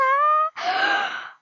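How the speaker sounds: a long, very high, loud gasping shriek. Real, not acted. Gamer girl clearing the hard part, shrieking gasp. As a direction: shriek with relief and joy.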